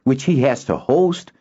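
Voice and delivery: high sharp retort; snapped back. Acted. Boy snapping back at his friend. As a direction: snap the words back. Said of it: "Kinden snapped back".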